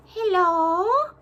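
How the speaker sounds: put-on high, sweet baby-talk hello; cooing greeting. Greeting the gnome in a high coo.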